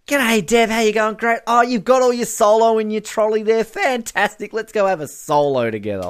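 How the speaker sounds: upbeat mock chat with an acquaintance; chirpy acted greeting. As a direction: play a cheery supermarket run-in. Imagined supermarket greeting to Deb.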